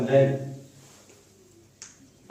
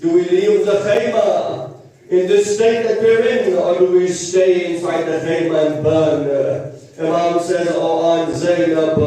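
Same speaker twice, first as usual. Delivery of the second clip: raised mourning-sermon voice; mournful lament preaching; 3 semitones higher, 4 dB louder than usual. Acted. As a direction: grieve aloud to the congregation, stretching the words. Mourning sermon at raised, drawn-out pitch.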